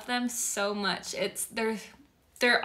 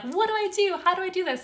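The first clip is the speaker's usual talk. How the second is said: high, pleading questions to herself; voicing her own overwhelm, higher and louder; overwhelmed inner panic; 9 semitones higher, 4 dB louder, faster than usual. Retelling her worry to viewers, high desperate questions. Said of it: "It's like".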